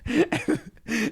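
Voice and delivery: high burst of laughter; hysterical laugh. Real, not acted. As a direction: let a high laugh break out. Laughing at friend's fall.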